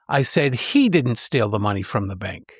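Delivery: "he" lifted in pitch and punched, the rest dropping low; emphatic "he", everything after it unstressed; stress on HE. Shown as a demonstration. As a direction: lean on "he", then drop back down immediately. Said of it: "The third suggests someone else might have stolen".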